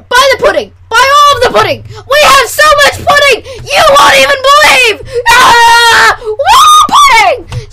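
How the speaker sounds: loud, high, building to a whoop; frenzied sales shouting. Acted. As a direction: whip yourself into a selling frenzy. Climax of shouted mock commercial.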